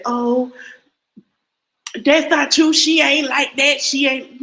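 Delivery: high, loud mock protest; mocking defenders. Mocking people who defend Brianna Hampton, high and loud. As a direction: ham up the fans' outraged denial. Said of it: "And people say".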